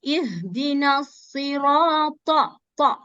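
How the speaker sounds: ihdina sirata slowly recited; slow recitation. Shown as a demonstration. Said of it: "It's a heavy letter".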